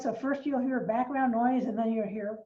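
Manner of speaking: ordinary talk, nothing marked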